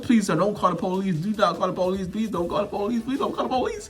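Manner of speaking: rapid, repeated plea climbing toward the end; begging. Retold or imitated. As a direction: plead over and over, tipping into a laugh. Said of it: "You're begging and stuff".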